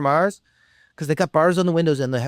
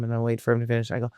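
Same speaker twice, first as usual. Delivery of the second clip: normal talking voice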